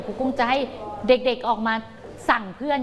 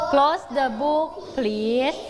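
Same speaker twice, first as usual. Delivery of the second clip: very slow model reading; much slower than usual. Shown as a demonstration. Teacher modelling the command to pupils. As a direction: Close the book please drawn out. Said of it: "คำสั่งนี้ล่ะคะ".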